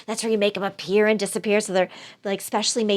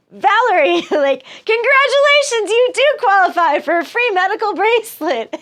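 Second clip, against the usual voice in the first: very high, loud mock promo voice through laughter; prize recording voice; 10 semitones higher, 5 dB louder than usual. Greg calling again gets the promo, hammed-up cheery. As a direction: squeal the canned prize offer, barely holding it together. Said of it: "He's like".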